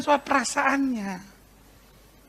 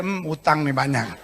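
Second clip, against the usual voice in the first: mock churchgoer muttering; 9 semitones lower, 5 dB quieter than usual. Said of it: "kalau lihat ada orang nangis di gereja".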